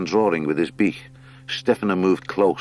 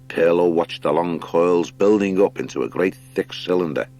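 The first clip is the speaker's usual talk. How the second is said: usual speaking voice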